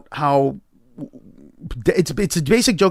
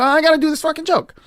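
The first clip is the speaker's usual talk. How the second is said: excited realization; 13 semitones higher, faster than usual. Retold or imitated. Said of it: "And I go".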